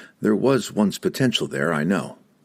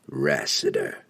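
grim; 5 semitones lower, 3 dB quieter, slower than usual. Acted. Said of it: "he said grimly".

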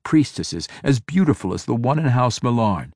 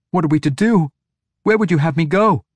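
high, loud anxious questions; alarmed young subordinate; frantic pleading; 8 semitones higher, 5 dB louder than usual. A narrator's reading. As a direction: plead for orders, voice pitched well up. Frantic young priestess begs orders.

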